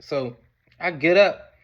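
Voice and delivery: ordinary delivery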